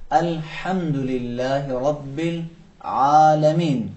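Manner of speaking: slow Quranic demo with a throaty ain. Shown as a demonstration. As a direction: press the middle of the throat on the ain in العالمین. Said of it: "حلق کے درمیانی حصے پہ زور لگانا تھا".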